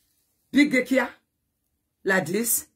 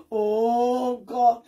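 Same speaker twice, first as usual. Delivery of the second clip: ordinary talk, nothing marked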